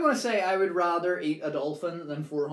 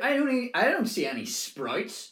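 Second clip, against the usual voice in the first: mock outrage; 10 semitones higher, 5 dB louder than usual. Mock complaint about Christmas dinner.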